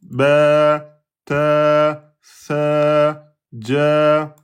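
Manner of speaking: "ba", "ta" held long and level; long a syllables. Shown as a demonstration. Teacher demonstrating long a after consonants. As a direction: sustain each syllable evenly. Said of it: "Le son sort et la bouche".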